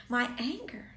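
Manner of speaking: ordinary delivery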